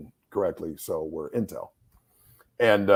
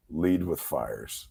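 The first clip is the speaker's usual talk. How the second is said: slow and deliberate; 5 semitones lower, 4 dB quieter, much slower than usual. Retold or imitated. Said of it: "I go".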